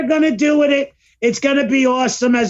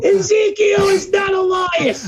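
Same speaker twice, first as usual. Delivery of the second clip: higher, shouted conspiracy rant; mock outraged rant; 7 semitones higher, slower than usual. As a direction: yell it like a convinced conspiracy nut. Same mock rant continues.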